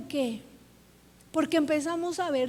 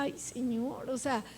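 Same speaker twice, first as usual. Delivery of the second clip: soft, much quieter retold weeping; tearful lament; 8 dB quieter than usual. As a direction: sigh it out like remembered tears. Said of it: "yo ayer lloraba mucho".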